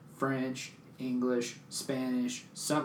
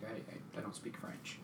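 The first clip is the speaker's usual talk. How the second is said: softer, almost unvoiced mumble; embarrassed, shrinking reply; timid whisper; 3 semitones lower, 17 dB quieter than usual. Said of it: "and I was like".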